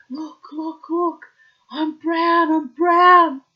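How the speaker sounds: high, loud, thrilled yell; excited shout. Retold or imitated. New Zealander thrilled at her first tan, shouting high and loud. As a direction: show off the new tan at full volume. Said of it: "I'd go".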